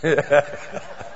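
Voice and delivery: brief amused laugh. Real, not acted. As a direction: chuckle after teasing someone. Laughs after teasing a listener.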